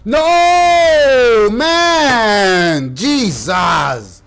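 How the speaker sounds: loud, high anguished yell; furious gamer outburst; real frustrated yell. Real, not acted. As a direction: howl at the death. Player dies, yells.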